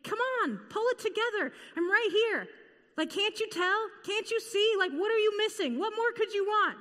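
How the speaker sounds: high, pleading, impatient scolding; exasperated mock Jesus. Preacher voicing the angry Jesus we imagine, exasperated. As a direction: push it like a frustrated teacher. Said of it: "angry and intense".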